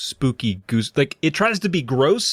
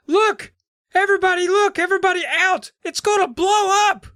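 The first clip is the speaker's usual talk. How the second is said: very high shrieking alarm; high mock screaming warning; mock shout. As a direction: shout a warning in a cartoon panic. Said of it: "someone shouts".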